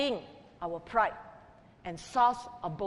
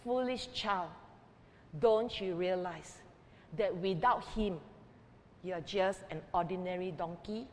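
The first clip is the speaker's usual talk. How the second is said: soft, low, tender rebuke; gentle; 4 semitones lower, 8 dB quieter than usual. A narrator's reading. Mother gently telling her hurt son, soft and low. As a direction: gently correct a foolish child. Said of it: "the mother said gently to him".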